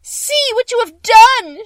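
very high, very loud, slow scream; witch screaming. Acted. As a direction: shriek in horror as you melt. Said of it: "she screamed".

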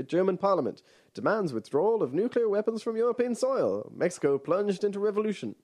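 high, punchy newsreel announcer; old-timey radio voice. Shown as a demonstration. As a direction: read it like a 1940s radio bulletin. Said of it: "Old-timey radio voice".

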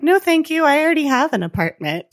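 high-pitched imitated brush-off; mock polite refusal. Quotes her polite refusal to him.

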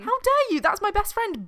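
high, loud mock indignation; a protective best friend, mock furious; mock outraged friend. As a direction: defend your friend in hammed-up outrage. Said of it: "the other one's like".

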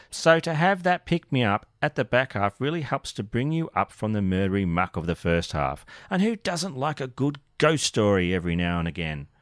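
ordinary delivery

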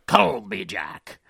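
slow pirate drawl on Colby Jack. Shown as a demonstration. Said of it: "sounds more like you're saying the name of another pirate".

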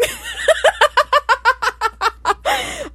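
high cackling laugh; laughing fit. Real, not acted. Host cracking up at the misheard lyric, laughing. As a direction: crack up helplessly.

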